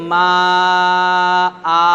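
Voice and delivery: মা held very long, then আ drawn out; pausal elongation. Shown as a demonstration. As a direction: stretch মা, stop on open আ without n. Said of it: "শ্বাস যদি ছেড়ে দিই".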